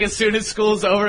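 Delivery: normal talking voice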